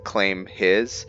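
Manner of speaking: normal talking voice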